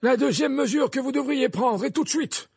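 high, loud, insistent defence; forceful headmaster. Acted.

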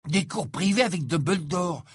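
impressed. Acted. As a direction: sound deeply impressed. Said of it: "répéta Ron impressionné".

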